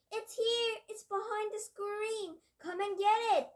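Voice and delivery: high, loud witch's cry of discovery; triumphant yell. Acted. Witch yelling to the others that she found him, triumphant and loud. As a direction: shriek it out in triumph. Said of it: "yelled triumphantly".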